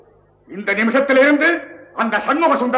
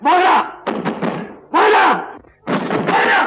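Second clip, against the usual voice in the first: urgent call; 9 semitones higher than usual. Acted. Calling at locked door.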